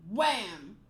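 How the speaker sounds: ordinary delivery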